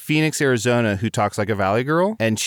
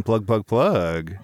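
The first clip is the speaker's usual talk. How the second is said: tag-like prompt; 3 semitones lower, much slower than usual. Pretend. Jokey prompt to advertise station.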